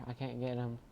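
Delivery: ordinary talk, nothing marked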